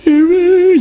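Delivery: retold crying. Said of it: "ya comienza a llorar".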